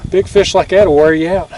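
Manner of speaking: ordinary talk, nothing marked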